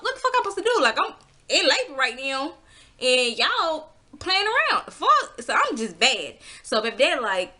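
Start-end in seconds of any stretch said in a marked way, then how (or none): 0.0-5.4 angry outburst. Retold or imitated. She yells at hospital staff about the empty ward, high furious rant. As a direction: let the anger spike at the empty desk. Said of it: "instantly getting pissed the fuck off".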